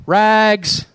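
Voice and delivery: a street vendor's clear cry; clear tenor call. Acted. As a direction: call out to the alleys. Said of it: "calling in a clear tenor voice".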